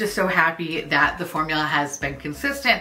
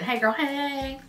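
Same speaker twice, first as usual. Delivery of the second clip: greeting; 7 semitones higher, 4 dB quieter, slower than usual. Retold or imitated. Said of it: "tell her I said".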